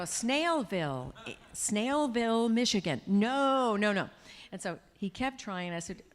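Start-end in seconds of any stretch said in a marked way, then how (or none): 0.0-4.1 louder, slow, stilted phone-assistant voice; imitating the phone assistant misunderstanding the name; mock Siri voice. Siri misreads the friend's request, mock robotic assistant voice. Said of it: "And Siri would go".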